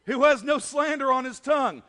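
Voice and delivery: high, loud, slow declamation of scripture; loud preached psalm reading; preaching at full force. Acted. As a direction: proclaim the psalm to the whole room.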